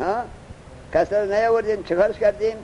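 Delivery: ordinary delivery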